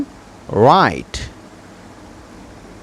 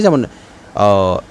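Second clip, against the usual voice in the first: usual speaking voice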